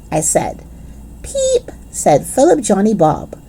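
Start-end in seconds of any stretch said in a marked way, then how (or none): 1.2-1.7 very high squeaky chick peep; tiny peep. Acted. Grace joins in peeping, high and squeaky. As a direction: squeak like a baby bird.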